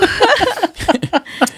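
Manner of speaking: high burst of laughter; loud laughter. Real, not acted. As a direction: crack up loudly.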